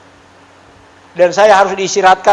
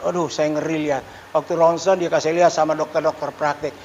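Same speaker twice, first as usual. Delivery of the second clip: ordinary talk, nothing marked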